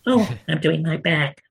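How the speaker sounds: high-pitched puppet beaver complaining. Acted. Beaver puppet grooming itself in audition sketch, squeaky beaver voice. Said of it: "doing my dreadful American accent".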